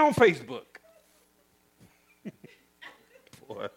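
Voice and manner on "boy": normal talking voice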